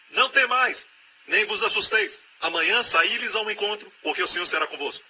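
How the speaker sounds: fervent pulpit declaration; ringing proclamation. Acted. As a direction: cry it out over the crowd. Preacher proclaiming scripture promise.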